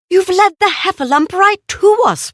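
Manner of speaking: sharp scolding rabbit voice. Acted. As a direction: tell them off like a fretful rabbit. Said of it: "Rabbit's voice reprimanded".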